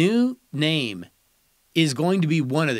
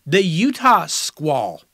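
drawn-out reveal of the name, a bit louder; slow name announcement; 4 dB louder, much slower than usual. Acted. Host unveiling a proposed team name, slowly announced. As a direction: announce it slowly like a draft pick. Reading out candidate team names.

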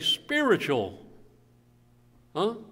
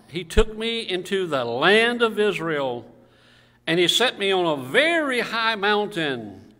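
scripture reading aloud; 3 semitones higher, 5 dB louder than usual. Real, not acted. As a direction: proclaim the verse to the congregation. Reads scripture to congregation.

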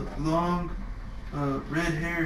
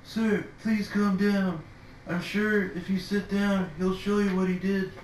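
higher, slow soothing plea; calming plea; 5 semitones higher than usual. Acted. Bard calming an angry swordsman, higher and slow. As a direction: gently talk the man down. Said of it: "be like".

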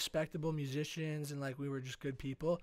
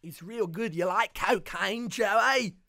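loud, drawn-out mock Australian; Australian accent; 5 semitones higher, 8 dB louder, much slower than usual. Bandmate tempting [name] in hammed-up Australian accent. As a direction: build to a high, slow Australian question. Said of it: "or, like, Australian, like the Bee Gees".

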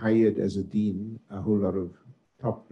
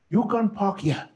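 bossy secretary; 11 semitones higher than usual. Retold or imitated. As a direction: imitate her shooing him off, pitched up. Secretary voiced in retold story.

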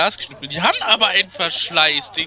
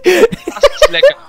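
slow, much higher, louder laughing; 9 dB louder, slower than usual. Real, not acted. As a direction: burst out laughing mid-sentence. Laughing commentary on the match.

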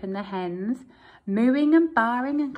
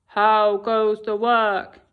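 lazy farmer calling; 2 semitones lower, 8 dB louder, slower than usual. Acted. Lazy farmer calling from bed to the duck. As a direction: call down lazily from bed.

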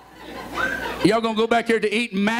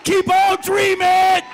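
full-force preaching; 3 semitones higher than usual. Acted. Pastor rallying the church to keep dreaming, building shout. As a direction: whoop it up to the climax.

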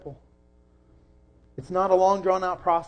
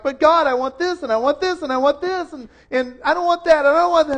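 much higher, louder mock whine at God; whining; 9 semitones higher, 8 dB louder than usual. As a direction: whine like a child demanding one thing after another. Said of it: "our whining self".

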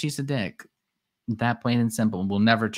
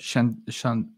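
ordinary delivery

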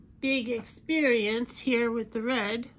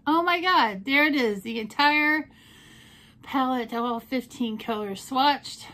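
excited reveal; 2 semitones higher, 6 dB louder than usual. Real, not acted. Vlogger showing viewers her swatches, loud excited gasp. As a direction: gush over the swatches.

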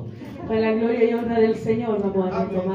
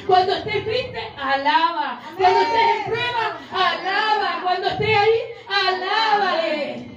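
preaching at full force; 8 semitones higher than usual. Acted. Preacher urging the congregation to praise, at full force. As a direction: build each line like a rising call. Preacher builds a repeated call.

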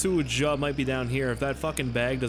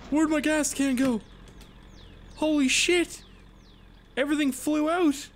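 startled outcry. Real, not acted. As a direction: cry out in alarm as everything goes wrong. Startled as items fly out.

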